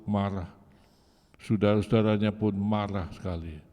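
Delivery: normal talking voice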